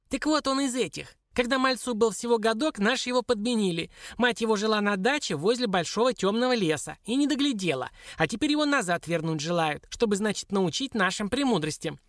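high, squeaky dwarf voice; high-pitched creature telling a secret; storytelling dwarf. Acted. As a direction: explain in a little imp's voice.

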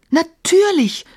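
high, excited realization; exclaiming. A narrator's reading. Emma realizes the answer, exclaiming excitedly. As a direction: it suddenly dawns on her. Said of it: "rief Emma".

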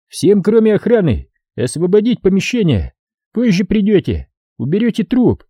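high-pitched, imperious orders; high, authoritative officer; commanding officer. A narrator's reading. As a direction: dismiss subordinates in a high, commanding voice. Commander dismisses men with orders.

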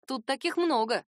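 ordinary delivery

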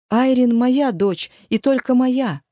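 loud, slow, high cry; desperate shout. Acted. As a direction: insist with all your force. Мать кричит в отчаянии. (отчаянный крик)

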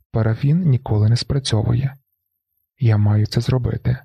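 ordinary delivery

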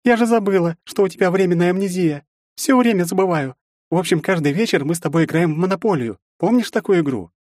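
high, bright exclamation; suddenly remembering, excited; exclaiming. A narrator's reading. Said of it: "воскликнула".

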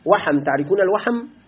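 ordinary delivery

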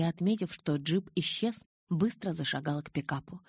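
usual speaking voice

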